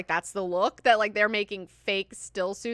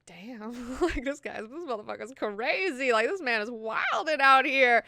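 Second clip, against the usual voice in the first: amazed onlookers; 4 semitones higher, 4 dB louder than usual. Retold or imitated. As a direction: imitate a crowd marvelling at a madman. Voicing amazed crowd after he leaves.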